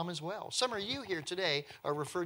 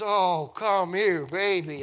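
slow, loud, tender coo; cooing to a baby; 4 semitones higher, 10 dB louder, slower than usual. Pretend. Princess scooping up the weeping baby Moses, slow tender cooing. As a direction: gather the crying baby up and soothe him.

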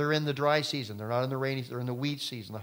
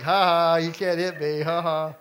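loud mocking sing-song taunt; loud jeering big cousin; taunting older cousin; 3 semitones higher, 8 dB louder than usual. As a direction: tease a smaller kid out of reach. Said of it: "He was just like".

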